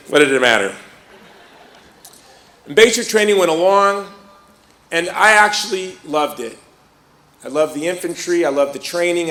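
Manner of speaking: ordinary talk, nothing marked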